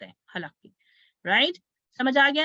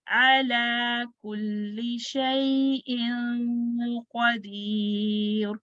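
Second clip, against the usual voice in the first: very slow, level chant with held vowels; measured Quran recitation; much slower than usual. Shown as a demonstration. Teacher models the verse for students, slow tajweed recitation. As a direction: recite slowly, drawing out each long vowel.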